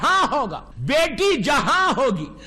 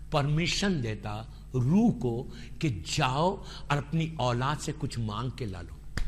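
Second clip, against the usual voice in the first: solemn hushed quote; 9 dB quieter than usual. Retold or imitated. Voicing the Prophet's solemn words.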